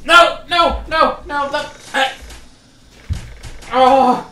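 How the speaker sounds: high, very loud frantic no no no; panicked no's. Real, not acted. Player panicking in the horror game as it goes wrong, frantic no's.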